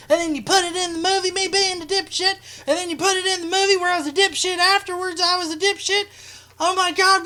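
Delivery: high, loud hammed-up whiny rant; mock tantrum. Mimicking them whining at the film crew, high mock tantrum. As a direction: rant like a caught-out crank throwing a fit. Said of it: "They're just like".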